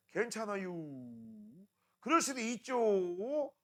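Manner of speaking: slow, drawn-out easygoing reply; lightly mimicked slow Chungcheong speech; imitating slow talker. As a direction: stretch each word like the slow Chungcheong junior. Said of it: "말이 정말 느린 거야".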